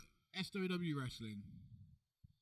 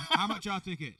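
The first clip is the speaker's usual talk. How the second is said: loud, higher promo voice; scripted ad read; 4 semitones higher, 11 dB louder than usual. Pretend. Hosts promoting ticket prices, hammed-up scripted ad voice. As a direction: read it like a teleprompter ad. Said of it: "Sounds like a reading a script".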